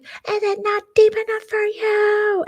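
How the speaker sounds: very high, drawn-out taunt; shrieky mock outrage; mock screaming. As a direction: imitate a screeching taunt, slow and high. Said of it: "they start screaming at each other".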